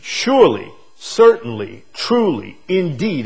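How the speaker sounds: each word punched loud; emphatic. Shown as a demonstration. Preacher stressing the Hebrew emphasis to the church. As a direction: hammer every synonym home. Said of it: "It's very emphatic in the Hebrew".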